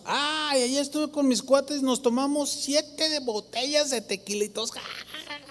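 mock a man bragging about his tequila binge; a hint of a cocky partier laughing it off; boastful drunk buddy. Mocks sinners boasting about drinking.

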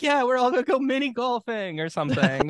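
lightly voiced team players, high and laughing; a hint of goofy jock; mock players' voice. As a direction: play a giddy ballplayer announcing plans. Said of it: "They're like".

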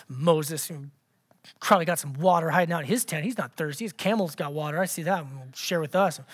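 low, soft muttering to yourself; grumbling complaint. Acted. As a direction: mutter resentfully under your breath. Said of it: "You're kind of complaining".

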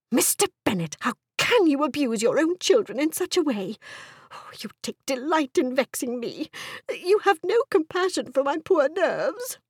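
high-pitched histrionic complaint; wounded, indignant wife. Acted. Wife accusing husband of vexing her, aggrieved. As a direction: scold as a put-upon, nervy wife.

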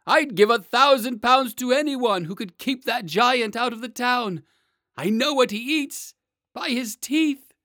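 high, loud, desperate official; frantic mayor. Acted. Mayor offers reward in fear.

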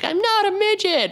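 put-on high, wailing little-girl protest; imitated crying kid. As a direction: whine it like a hurt fourth grader. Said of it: "she just started crying".

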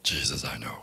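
low, unvoiced demon whisper. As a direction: hiss it like a sly demon. The demon answers them.

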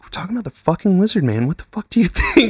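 high, fast, half-laughing outburst; freaked-out exclaim. Retold or imitated. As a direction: blurt it at mom in a panic. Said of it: "We're like".

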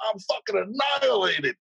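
high-pitched mock drunk blurt; retold drunken confession. Drunk man admitting it to the cop, hammed-up high blurt.

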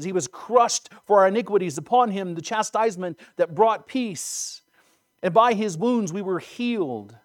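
fervent, ringing recitation of scripture; impassioned scripture recitation. A narrator's reading. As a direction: declaim the prophecy with swelling force. Recites Isaiah with force.

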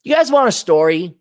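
normal talking voice

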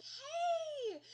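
very high, loud, drawn-out greeting; mock friendly hail. Director pulling his actor aside, hammed-up high hail.

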